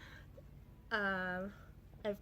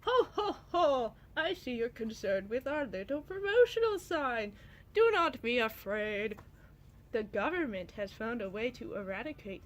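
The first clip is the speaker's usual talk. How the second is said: villain voice; 5 dB louder than usual. Acted. Reading her comic aloud, the government official gloats at a homeless girl. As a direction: boom out a jolly sinister official. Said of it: "And then Fish says".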